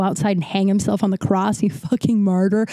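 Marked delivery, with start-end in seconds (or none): none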